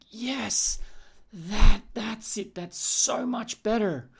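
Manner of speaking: delighted client. Acted. Client reacting in future pace.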